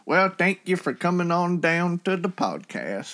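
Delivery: put-on Texas accent. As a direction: play a folksy Southern host with a Texas accent. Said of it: "British people doing Texas accents".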